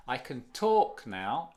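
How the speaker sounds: "can" squashed down, "talk" loud and drawn out; suppressed can, stressed talk. Shown as a demonstration. Teacher showing where the stress falls, on "talk". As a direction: punch "talk" and let "can" nearly vanish. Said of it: "suprimido la entonación".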